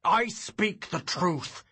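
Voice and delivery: slow, high bellow. Acted. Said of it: "he bellowed".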